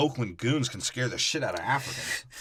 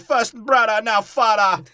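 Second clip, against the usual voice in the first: high, loud, hammed-up lament; mock queen mother; 9 semitones higher, 9 dB louder than usual. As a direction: wail it like a grieving queen. Mother voiced in retelling.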